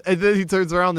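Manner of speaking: usual speaking voice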